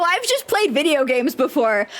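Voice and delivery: normal talking voice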